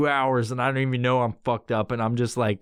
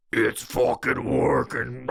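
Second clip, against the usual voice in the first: ominous low voice; 4 semitones lower, slower than usual. Retold or imitated. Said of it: "it was like".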